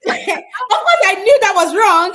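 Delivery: laughing. Real, not acted. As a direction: laugh it out at yourself. Laughing at her own stubbornness.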